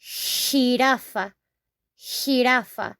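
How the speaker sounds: slow "Jirafa" with a drawn-out h; Spanish J sound. Shown as a demonstration. Teacher pointing out the sound, slow "Jirafa" as hee-RAH-fah. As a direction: stretch the opening h of "Jirafa". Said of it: "Look at the sound".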